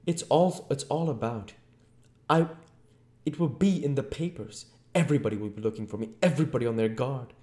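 high, loud, rushed ranting; worked-up, high-pitched tirade; agitated invisible Voice. A narrator's reading. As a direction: rant with rising panic at being hunted.

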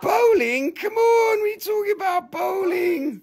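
very high-pitched squealed protest; hammed-up incredulity; mock disbelief. Seller protests a customer leaving for bowling, mock disbelief.

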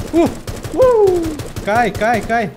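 high shouts of excitement; excited yelling. Real, not acted. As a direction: yell it mid-firefight. Intense fight moment in game.

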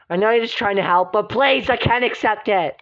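ordinary talk

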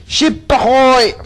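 high, drawn-out sailor's call; lookout call. Acted. Lookout to the crew sighting a ship, high drawn-out call. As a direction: call out slowly and high across the deck.

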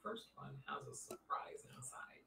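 whispered secret, no voice. Real, not acted. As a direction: hush it like a secret surprise. Telling a secret surprise.

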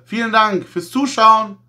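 ordinary talk

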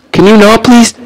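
begging an enemy to back off, high and loud; high pleading whine. Real, not acted.

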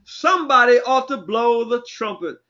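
high, ringing preacher's call; impassioned preaching. Real, not acted. Preacher urging somebody to blow the trumpet, impassioned preaching. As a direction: call the people to action.

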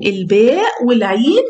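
normal talking voice

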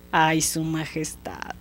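soft, slow, drawn-out sigh of regret; low, sheepish apology; mock dismay. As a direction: lament meekly to the king, dragging it out. Boy feigns dismay before the king.

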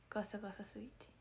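whispered through a worn-out voice; real strained whisper after shows; hoarse voice. Real, not acted. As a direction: spare a hoarse throat with a whisper. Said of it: "声がガサガサで".

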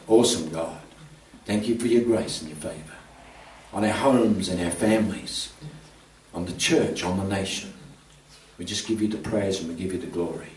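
low, measured praying voice with pauses; earnest prayer. Real, not acted. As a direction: lead the congregation in prayer, softening into hushed worship. Leads a closing prayer.

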